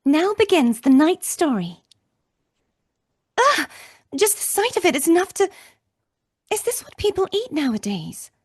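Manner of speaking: bright, lifted anime heroine voice; high character voice. Acted. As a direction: raise the pitch into a young character. Live line read, character voice.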